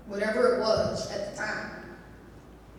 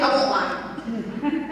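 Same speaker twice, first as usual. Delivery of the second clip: ordinary talk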